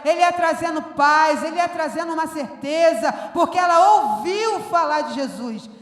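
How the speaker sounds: loud, driving pulpit preaching; fiery preaching. Acted. Pastor rallying the church with loud, driving preaching. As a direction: preach it to the back row, full force. Preaching full force again.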